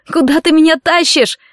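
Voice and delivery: high-pitched panicked question; alarmed cry. A narrator's reading. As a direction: cry out in protest. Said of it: "закричала Кэтрин".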